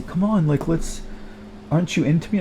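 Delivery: imagined woman pleading. Retold or imitated. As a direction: play the girlfriend asking if he's into her. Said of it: "and she's gonna say".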